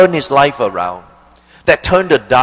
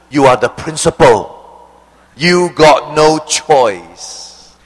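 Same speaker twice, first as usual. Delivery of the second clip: louder, then drawn right out word by word; emphatic mother; 3 dB louder, slower than usual. Acted. Mother telling her son he must go to school, slow and emphatic. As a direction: stretch the last words slowly for the punchline.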